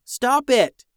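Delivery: high, urgent shout; alarmed cry. A narrator's reading. Harvey tells shapeshifting Reed to quit, high alarmed cry. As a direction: cry out to make him stop. Frightened brother orders Reed to stop.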